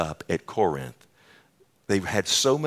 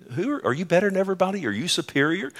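mocking; 10 semitones higher, faster than usual. Preacher mocks the boastful church.

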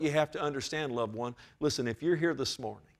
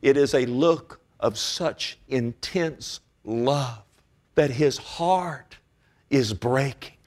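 slow, weighty preaching; 4 dB louder, slower than usual. Real, not acted.

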